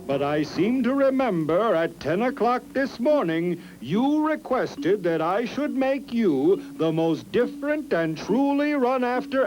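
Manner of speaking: a grand god calmly reminding the kangaroo; god Encong. Acted. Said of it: "said Encong".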